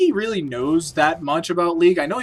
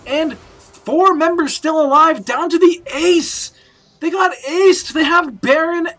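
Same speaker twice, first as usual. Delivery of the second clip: excited; 9 semitones higher, 4 dB louder, slower than usual. Real, not acted. Shutdown call, team aced.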